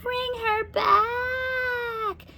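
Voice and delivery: high, long witch wail. A narrator's reading. Witch Beryl wails as the doll is taken. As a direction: howl it out in anguish.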